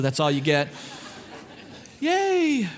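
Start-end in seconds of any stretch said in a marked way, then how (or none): 2.0-2.7 put-on high squeal of a cheering kid; mock kid cheer. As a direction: cheer like a thrilled little kid.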